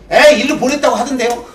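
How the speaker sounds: loud mock objection; acted insistence. Acting out Iseon's insistence. (큰 소리로 우기는 척)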